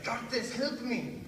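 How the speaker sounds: high, drawn-out plea from a trap; mock cry for help. Roommate caught in a bear trap, hammed-up cry for help. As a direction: plead for help, high and slow.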